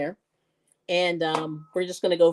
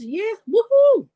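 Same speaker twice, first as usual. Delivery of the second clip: high, loud, slow woohoo; all excited; 14 semitones higher, 4 dB louder, slower than usual. Real, not acted. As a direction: cheer her on with real delight. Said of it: "Sorry, I'm all excited".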